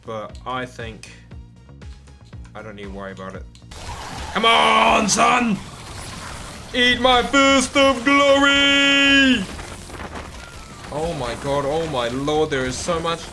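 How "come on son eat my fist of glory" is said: high, slow shouted war cry; hyped battle cry. Pretend. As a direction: roar it like a triumphant hero. Charging into fight yelling.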